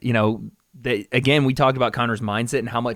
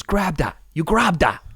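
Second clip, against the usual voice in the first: higher-pitched, muddled Irish-British impression; bad Connor accent; 5 semitones higher, slower than usual. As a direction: coach someone in a wobbly mock Irish accent. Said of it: "I don't know what accent I'm doing".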